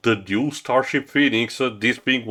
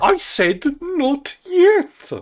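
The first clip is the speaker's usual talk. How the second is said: much higher, slow, shouted tirade; furious shout; 3 dB louder, much slower than usual. Acted. Zog screaming at his assistant, furious and much higher. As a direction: blow up at the subordinate.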